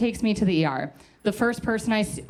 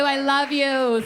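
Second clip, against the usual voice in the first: "I love you" stretched slowly, pitch lifted on "love"; drawn-out declaration; 7 semitones higher, 3 dB louder, slower than usual. Shown as a demonstration. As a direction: lean into "love", slow and higher, hamming up the solidarity. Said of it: "we need solidarity".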